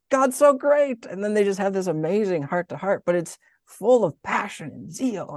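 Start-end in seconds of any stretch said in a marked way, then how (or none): 0.1-1.0 high, loud burst of delighted praise; super excited. Retold or imitated. Elizabeth greeting Mary at the door, super excited. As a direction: exclaim it like an overjoyed friend. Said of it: "Elizabeth's super excited".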